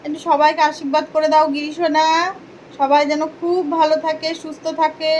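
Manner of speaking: sing-song doting on Giri sona; affectionate blessing. Acted. Blesses viewers through the doll. (আদুরে গলায় আশীর্বাদ)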